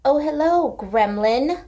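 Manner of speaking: high and loud, thrilled to see the dog; a bright squeal of hello for the little pest; delighted pet greeting. Real, not acted. Greeting the dog that wandered in, high and delighted.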